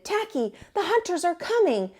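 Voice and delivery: begging him to stop; pleading. Acted. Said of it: "who pleaded".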